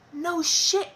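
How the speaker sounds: high, loud sarcastic comeback; exasperated retort. Retold or imitated. Labouring mother to slow nurse, exasperated. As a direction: throw it back at them, high and loud.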